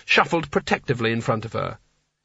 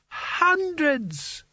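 much higher, dreamy boast; tipsy Seraph. A narrator's reading. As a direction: float the word up proudly.

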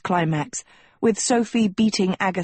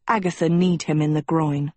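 plain reading voice

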